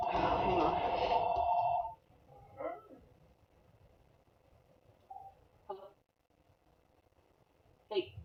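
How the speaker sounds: quiet, off-mic side call; talking away from the microphone; turning away from phone. Real, not acted. Taking husband's call mid-stream.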